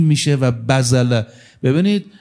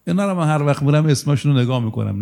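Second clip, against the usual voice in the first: ordinary talk, nothing marked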